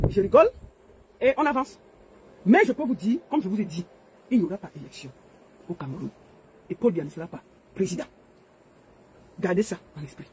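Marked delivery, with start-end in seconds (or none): 4.3-10.3 grave quiet prediction. Real, not acted.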